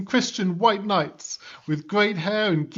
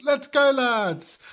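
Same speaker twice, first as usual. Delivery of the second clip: higher, slow rallying call; a hammed-up rally cry; eager battle cry; 5 semitones higher, slower than usual. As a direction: hype up the lads for the charge. Knights cheering before the charge.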